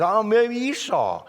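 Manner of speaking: high, drawn-out answer; puzzled Esau. Retold or imitated. Son answering his confused father, puzzled and high-pitched. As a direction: stretch it out in puzzlement.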